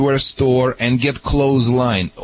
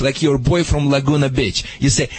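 ordinary talk, nothing marked

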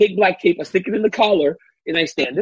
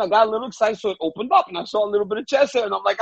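ordinary delivery

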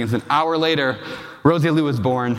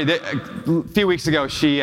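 usual speaking voice

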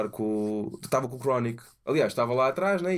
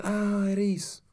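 surprised realization; 10 semitones higher, slower than usual. Retold or imitated. Retelling people's reaction.

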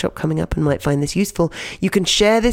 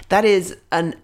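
normal talking voice

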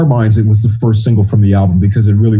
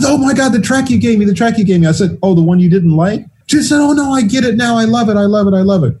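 thrilled Janet; 10 semitones higher than usual. Retold or imitated.

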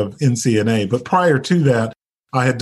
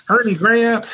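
high, laughing Southern lady voice; Southern accent; 9 semitones higher than usual. Retold or imitated. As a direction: imitate a sweet Southern friend. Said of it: "in her nice little Southern voice".

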